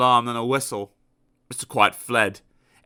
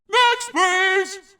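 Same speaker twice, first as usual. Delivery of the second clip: much higher, very loud call; shouting next; 5 dB louder than usual. Acted. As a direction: dismiss him with a yell. Shouting shopkeeper.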